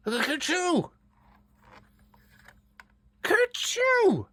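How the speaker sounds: high, squeaky little sneeze twice; a small animal sneezing; acted sneeze. Grass tickles the fur child's nose, high sneeze. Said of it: "and made him sneeze".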